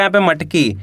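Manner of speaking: usual speaking voice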